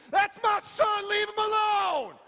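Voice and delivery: a parent hollering from the bleachers; parent yelling. Retold or imitated. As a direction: shout it at the other team from the stands. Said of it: "yelling at the other team".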